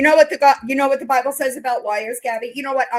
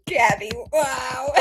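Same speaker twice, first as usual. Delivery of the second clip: ordinary talk, nothing marked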